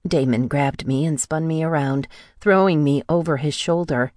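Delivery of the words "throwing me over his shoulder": ordinary delivery, nothing marked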